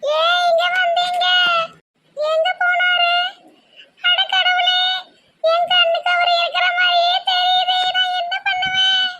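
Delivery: squeaky lament with long quavering endings; distressed young woman. Acted. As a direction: play a tearful girl panicking to herself.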